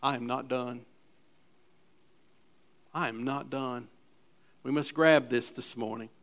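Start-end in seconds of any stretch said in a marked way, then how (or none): 0.0-0.9 quiet emphasis. Shown as a demonstration. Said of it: "And listen". 2.9-3.9 I am not done dropped lower and quieter; hushed echo of I am not done; softer repeat. Shown as a demonstration. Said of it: "And listen".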